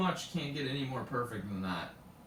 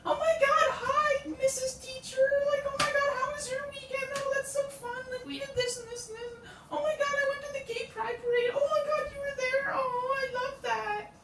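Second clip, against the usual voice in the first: very high, squeaky mock suck-up voice; gushing students. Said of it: "they come in and they're like".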